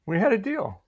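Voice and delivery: high-pitched playful insistence; mock protest. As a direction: insist on it innocently, pitched well up.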